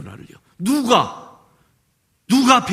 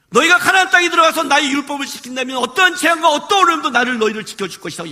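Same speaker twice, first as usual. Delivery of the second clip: preaching God's promise; 7 semitones higher than usual. Real, not acted.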